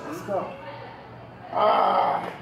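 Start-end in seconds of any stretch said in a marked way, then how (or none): 1.5-2.3 deep strained grunt; lifting grunt. Real, not acted. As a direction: grunt hard under the weight. Straining under the bar.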